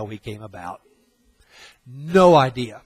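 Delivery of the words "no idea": loud, punched dismissal; a flat, loud verdict; emphatic scoff. Real, not acted. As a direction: slam it down: no idea. Preacher scoffing at scientists.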